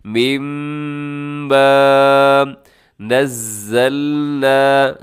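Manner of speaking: मीम ब held through the nose, slow; nasal ghunna. Shown as a demonstration. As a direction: drag the hum, then नज़्ज़लना. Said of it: "आवाज़ को नाक में लेके जाना है".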